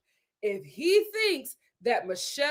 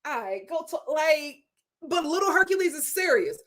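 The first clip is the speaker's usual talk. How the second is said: ordinary talk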